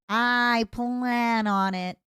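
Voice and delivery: I plan on it, drawn out very slowly; slow menacing. Acted. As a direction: savour each word of I plan on it.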